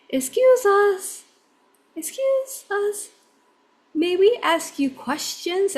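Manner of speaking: imitating little kids, high and slow; high-pitched, careful learner's English, politely asking; Japanese children's English. Little Japanese boys approaching tourists to ask questions, high careful accented voice. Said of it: "they have, like, you know, Japanese, um, accent".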